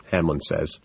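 usual speaking voice

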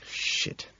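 hushed, breath-only swearing; whispered curse. A narrator's reading. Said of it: "I curse".

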